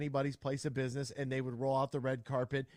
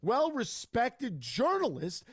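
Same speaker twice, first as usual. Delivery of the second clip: heated rant; 7 semitones higher than usual. Real, not acted. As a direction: go off in outrage. Host rants against Nebraska guest.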